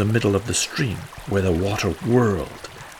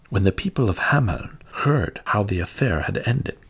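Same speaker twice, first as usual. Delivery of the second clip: ordinary delivery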